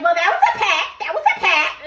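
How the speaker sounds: high, loud, laughing through it; cracking up while quoting Brittany. Retold or imitated. Brittany laughing as she insists it was a pact. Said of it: "And Brittany's like".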